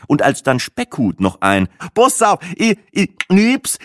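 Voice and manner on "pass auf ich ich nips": high, loud jokey voice; mocking guest, much higher; joking tourist. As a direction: crack a silly joke for the table.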